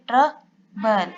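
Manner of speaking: ट्रबल said very slowly as ट्र-बल; split into syllables. Shown as a demonstration. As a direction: divide ट्रबल before the ब, slowly. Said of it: "बी से हम इसकी division करेंगे". (ट्रबल को ट्र-बल करके धीरे बोलें)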